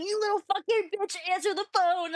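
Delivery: high-pitched, fast mock rant; mock Keem rant. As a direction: squeal the insult like a ranting streamer. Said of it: "He's like".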